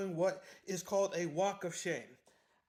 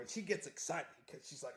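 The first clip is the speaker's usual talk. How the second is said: normal talking voice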